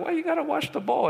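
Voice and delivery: quick, high-pitched imitation of a puzzled kid; questioning friend. Friends question the bowl washing, high quick puzzled voice. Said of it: "my friends would be like".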